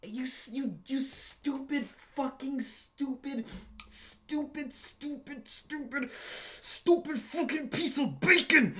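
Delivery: high, slow, building to a loud yell; mock fury ending in a loud shout; hammed-up rage. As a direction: wind up into a ridiculous tantrum. Escalating insult rant.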